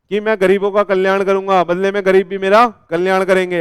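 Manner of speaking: mock the donor bargaining with the poor; hammed-up charitable boast. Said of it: "ऐसे बोलते हो क्या".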